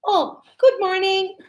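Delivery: high, bright-toned "Oh, good morning" customer service voice; polite receptionist. Retold or imitated. Receptionist greets a hotel guest, polite service voice. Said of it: "they're going to say to me".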